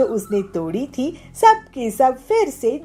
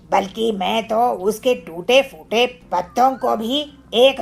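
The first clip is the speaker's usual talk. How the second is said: lightly voiced gloating villain; scheming villain. Kalu plots at night to smash bangles, gloating. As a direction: plot it with relish.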